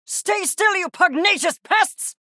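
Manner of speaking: high-pitched scolding yell; exasperated shout. Acted. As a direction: yell at the pests to obey. Scolding pests that keep moving.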